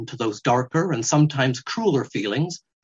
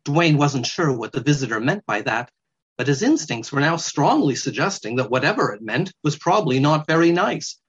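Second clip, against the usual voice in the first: ordinary delivery, nothing marked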